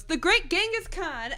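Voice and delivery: high, loud imitated announcement; mock proclamation. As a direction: burst in grandly over the top. Cuts in with an acted proclamation.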